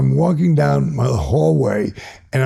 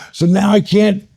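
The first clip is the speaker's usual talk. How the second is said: normal talking voice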